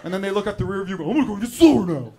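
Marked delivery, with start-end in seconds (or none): 1.1-2.1 loud mock outcry; hammed-up raised wail of disbelief; exasperated driver. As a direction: cry out like a driver held up. Acts driver reacting after a beep.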